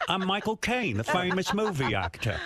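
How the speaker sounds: slow, hammed-up Cockney accent; put-on Cockney, stretching the words; Michael Caine. Host showing off as a film star, exaggerated Cockney accent. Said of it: "Oh, that's Cockney".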